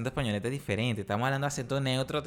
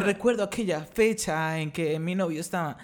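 higher, mock neutral Latin American dubbing accent; 4 semitones higher than usual. Podcaster tries to talk like Discovery Channel, neutral accent. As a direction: put on a hammed-up neutral accent. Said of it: "Quiero oír el acento neutro".

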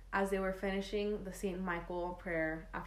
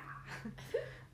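breathy high giggle; whispered giggle; 8 dB quieter than usual. Real, not acted. Giggle after the quote.

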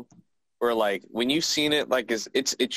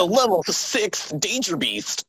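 lightly acted, higher-pitched danger announcement; mock anime exposition; 7 semitones higher than usual. Host parodying the show's beast levels, mock-dramatic announcement.

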